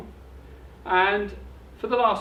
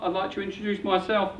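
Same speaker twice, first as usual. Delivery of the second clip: ordinary talk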